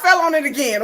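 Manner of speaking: ordinary talk, nothing marked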